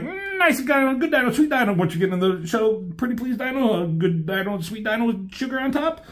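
high sweet-talking wheedle; coaxing Blur. Retold or imitated. Blur coaxing a Dinobot into the shuttle, high sweet wheedle. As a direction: coo at it like a pet you're luring.

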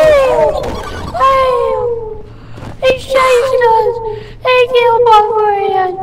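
very high, loud wailing scream; drawn-out, hammed-up horror shriek; acted terror. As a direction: scream and wail as if being chased. Acted victim chased by killer.

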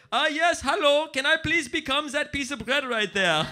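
put-on high, eager German accent; exaggerated German tourist English. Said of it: "just for the shitty English".

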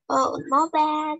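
ordinary talk

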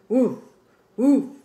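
loud barked woof, woof; startled dog bark. Acted. Startled dog barks loudly at the kitten climbing on him. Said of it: "said the startled dog".

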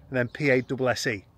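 ordinary talk, nothing marked

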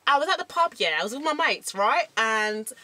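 animated Cockney pub complaint; put-on Cockney storyteller; Cockney accent. Shown as a demonstration. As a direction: rant about it in a Cockney accent. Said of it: "we're doing Cockney".

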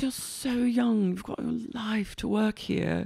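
high, soft, breath-fading wonder; put-on hushed boss. Boss advising young waitress, soft put-on wonder. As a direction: hush it, mock-earnest. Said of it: "she goes".

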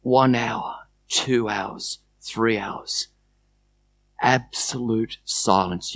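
slow, low, soft narration; long pauses, let each phrase settle; hushed, unhurried release; guided imagery. Acted. Leads imagined journey to its release.

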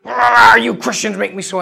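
loud angry shout, imitating the man; retold angry outburst. Preacher retelling a man's rant about Christians, imitated angry shout. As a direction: bark it back the way the angry man did. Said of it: "and he got angry".

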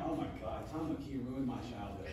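low, quiet mock complaint; imitating upset fans. Host voicing backlash from fans, low mock dismay. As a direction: softly mimic fans' outrage, pitched well down. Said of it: "of people being like".

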